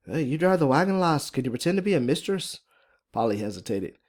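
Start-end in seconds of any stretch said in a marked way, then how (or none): 0.1-2.6 higher-pitched Irish lilt; thick Irish accent. Acted. Fiona asks Polly to play mistress, thick Irish accent. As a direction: ask in a strong Irish brogue. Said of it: "Irish accent is pretty thick and strong".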